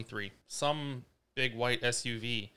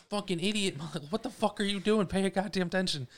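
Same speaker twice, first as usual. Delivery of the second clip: high-pitched road-rage rant, retold; re-enacted scolding in a raised pitch; retold angry yell; 8 semitones higher than usual. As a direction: berate the other driver, pitched up. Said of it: "fucking yelled at him".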